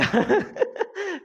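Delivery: short high laugh; quick bright burst of laughter. Real, not acted. Teacher laughing to cheer up students, high laugh.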